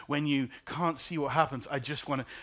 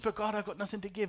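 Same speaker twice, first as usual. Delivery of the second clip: higher, fast pleading protest; 7 semitones higher, faster than usual. Retold or imitated. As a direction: push back, desperate and quick. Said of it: "I said".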